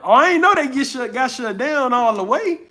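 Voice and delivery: genuinely taken aback, voice jumping up; surprised. Real, not acted. Streamer reacting to chat saying Roblox is shut down, surprised. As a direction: caught off guard by the news, pitch leaping.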